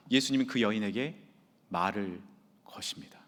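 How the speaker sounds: hushed whisper. Real, not acted. 설교 중 낮춰 속삭임.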